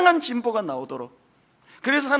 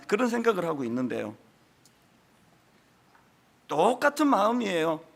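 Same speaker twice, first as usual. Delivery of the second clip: usual speaking voice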